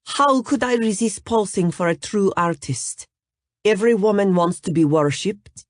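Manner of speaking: put-on teasing, lightly accented; light Russian accent. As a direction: model flirts with Nash in a light Russian accent. Natalia flirting with Nash.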